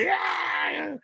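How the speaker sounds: high, stretched-out stunned yeah; dazed yeah. Retold or imitated. Comic after the nod from his idol: high awestruck yeah. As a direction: let it float up in awe. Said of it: "I'm like".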